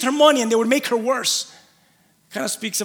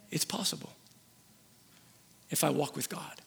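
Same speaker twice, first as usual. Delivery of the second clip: it's possible said much softer and lower; quiet to yourself; 9 semitones lower, 18 dB quieter than usual. Shown as a demonstration. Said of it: "Say that to yourself today".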